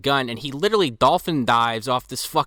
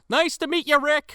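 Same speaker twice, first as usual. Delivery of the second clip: high-pitched mock friendly hello; put-on high sociable voice; waving greeting. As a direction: imitate a breezy greeting tossed at guards. Imagined greeting while waving at guards.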